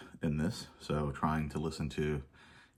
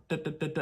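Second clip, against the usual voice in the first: high sung-out da da da da drum imitation; mimicking drum hits; 10 semitones higher, faster than usual. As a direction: tap out identical snare hits with your voice. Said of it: "and it's like".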